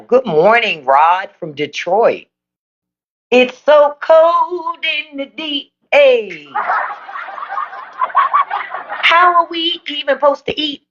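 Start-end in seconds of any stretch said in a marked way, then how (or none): 3.3-10.8 put-on commenter voice. Reading a Detroit viewer's comment, high put-on voice.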